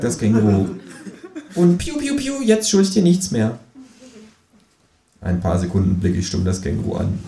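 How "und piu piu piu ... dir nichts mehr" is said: high, cheeky kangaroo voice. Acted. Kangaroo asks narrator to shove over, high cheeky. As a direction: pitch way up for the cheeky marsupial.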